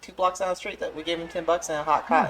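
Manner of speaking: high, loud put-on voice; mocking voice. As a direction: parody a careless recruiter.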